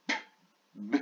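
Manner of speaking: "P" and "B" as short, separate lip pops; isolated letter sounds. Shown as a demonstration. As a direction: sound out "P", pause, then "B". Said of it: "c'est pas le même son". (sons « P » puis « B » brefs, bien séparés)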